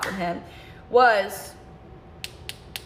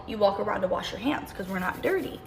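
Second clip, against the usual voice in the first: ordinary talk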